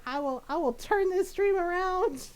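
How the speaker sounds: high, loud whiny threat; mock threat. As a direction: wail it like a stroppy kid. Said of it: "Golden's just like".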